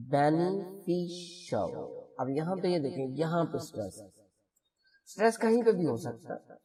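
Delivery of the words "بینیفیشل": بینیفیشل slow, stress on the third syllable; slow stress demo. Shown as a demonstration. Teacher showing students where the stress falls.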